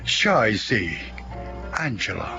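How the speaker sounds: ordinary delivery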